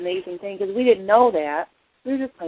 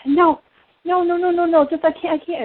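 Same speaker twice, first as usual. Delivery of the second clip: high, loud frightened no, no, no; a frightened mother's refusal, replayed; panicked refusal; 11 semitones higher, 7 dB louder than usual. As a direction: beg her to stop in a panic, half retold. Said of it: "she's going".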